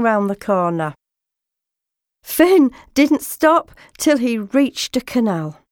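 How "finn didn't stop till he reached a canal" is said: ordinary delivery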